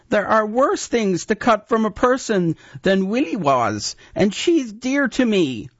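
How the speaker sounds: a pleading apprentice; frightened boy. A narrator's reading. Oik pleads.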